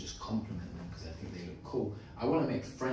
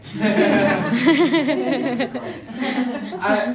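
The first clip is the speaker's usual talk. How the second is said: laughing; 15 dB louder, much slower than usual. Real, not acted. Laughs at own rambling.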